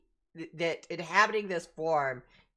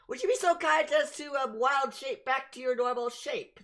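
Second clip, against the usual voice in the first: mild high-pitched call upward; lightly acted polite yell to someone overhead; 8 semitones higher than usual. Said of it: "He yells up at you".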